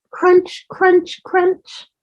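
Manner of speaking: high, breathy whispered crunches. A narrator's reading. Reading to a child, last shell pieces, high whispered crunches. Hatching sound effect.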